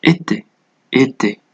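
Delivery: "إي تي" as é-té with thin, closed vowels. Shown as a demonstration. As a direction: repeat "إي تي" with a narrow é in both syllables. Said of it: "ويكون نطق الحرف مرقق".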